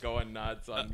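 usual speaking voice

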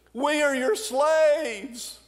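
high, slow pleading cry; pleading brothers. Retold or imitated. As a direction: beg for mercy, drawn out high. Brothers begging Joseph.

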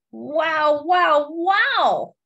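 rising, delighted wow wow wow; excited wows. Acted. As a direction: marvel at the fireworks for the kids. Fireworks page read to children.

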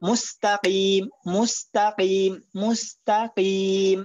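মুস্তাকিম slowly with a clear qaf, no extra t; slow drill of মুস্তাকিম; correct qaf. Shown as a demonstration. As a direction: repeat মুস্তাকিম slowly, sounding each letter. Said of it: "এখানে মুস্তাত নাই".

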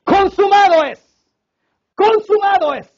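high, loud, slow proclamation; cry at full lung. Acted. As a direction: bellow it out at full power. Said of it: "clamó a todo pulmón".

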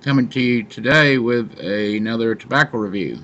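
talking around pipe stem; pipe in mouth. Real, not acted. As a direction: lips clenched on pipe. Seen 0: Pipe stem held between lips throughout the labelled words.

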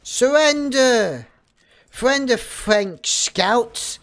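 loud, drawn-out battle cry; calling out. Pretend. Streamer demanding the French Scout surrender, slow loud call. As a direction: stretch it out across the battlefield. Calls out to enemy player.

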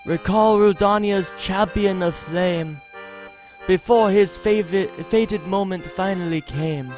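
put-on lilting, thickly accented bard voice; measured, musical accented delivery of a wandering minstrel; travelling bard with foreign accent. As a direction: read it as a courtly accented bard.

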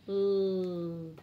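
L as a long low "lll"; letter sound. Shown as a demonstration. Teacher drilling letter sounds, L as "lll". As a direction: hold L with no vowel before it. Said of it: "L, leaf".

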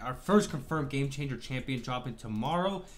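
ordinary talk, nothing marked

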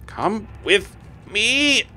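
high slow theatrical call; mock villain summoning his army; hammed-up grand summons. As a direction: play the mad overlord.

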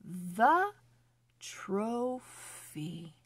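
slow, careful storybook reading; reading to children. Acted. Teacher reading a phonics story to children, slow and clear. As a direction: read each word clearly for young readers.

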